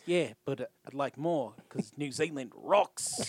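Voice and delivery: imitated New Zealand accent, slow; Rhys Darby. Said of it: "I was sold on his accent".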